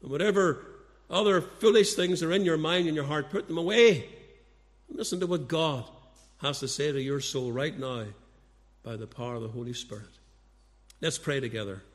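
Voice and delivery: ordinary delivery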